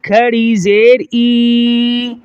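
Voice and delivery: slow spelling chant, "ای" drawn out long and level; کھینچ کر ادائیگی. Shown as a demonstration. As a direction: hold the final "ای" as a long ee. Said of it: "ایک الف کے بقدر کھینچا جائے گا". ("ای" کو لمبا کھینچ کر)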